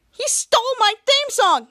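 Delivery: high, indignant protest; mock outrage. Ray complaining about the new hero, high mock outrage. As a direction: complain loudly, pitched well up.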